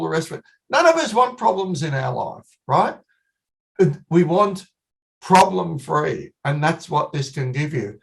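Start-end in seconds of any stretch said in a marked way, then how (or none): 5.2-6.3 "problem-free" slow and deliberate; stress problem-free. Shown as a demonstration. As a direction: spell out "problem-free" as the key point. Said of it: "I want to emphasize that word problem-free".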